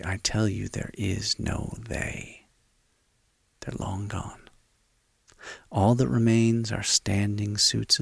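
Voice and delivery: soft, close, intimate voice; right in your ear. Real, not acted. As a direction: lean in and speak softly. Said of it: "as I whisper in your ear".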